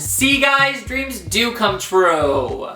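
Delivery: high, slow sing-song; milk the happy ending; cheesy. Real, not acted.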